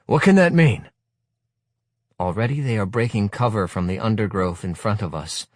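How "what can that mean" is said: sudden alarmed question; startled. A narrator's reading. Narrator startled by noise in the wood, alarmed. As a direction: blurt it out, alarmed.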